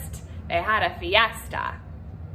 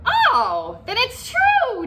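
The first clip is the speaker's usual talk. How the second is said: excited declaration; 14 semitones higher, 6 dB louder, much slower than usual. Acted. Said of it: "declared Don Diego".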